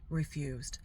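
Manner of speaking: "Refused" breathed out as a bitter whisper; whispered emphasis. Real, not acted. Mother recalling her ex's refusal, whispered with disgust. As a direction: drop to a whisper on "Refused" to let it sink in.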